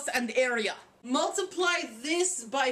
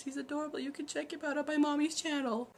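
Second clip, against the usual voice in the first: usual speaking voice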